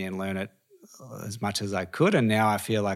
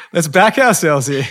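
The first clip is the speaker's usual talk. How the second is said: loud, high, laughing rally; hammed-up self-cheerleading, pitched high; mock pep talk; 8 semitones higher, 10 dB louder than usual. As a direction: hype the team up through laughter. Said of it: "you're just like".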